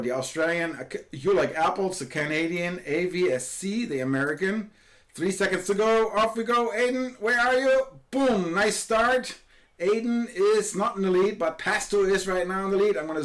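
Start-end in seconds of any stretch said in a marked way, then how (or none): 5.1-13.0 excited race start. Real, not acted. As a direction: cheer the start and hunt for Aiden. Race start live commentary.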